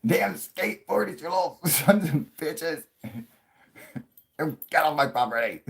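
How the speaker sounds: very high, loud cranky codger with laughs breaking in; laughing through a high old-man shout; grumpy old man yelling. Pretend. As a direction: chase the skateboarders off, hamming up the old crank. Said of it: "That's what I'm gonna be doing".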